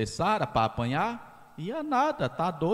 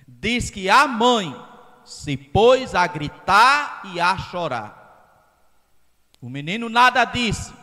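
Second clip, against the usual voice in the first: preaching at full force; 6 semitones higher, 7 dB louder than usual. Acted. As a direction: hammer the point home to the congregation. Preacher's climax to the congregation.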